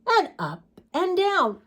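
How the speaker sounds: ordinary delivery, nothing marked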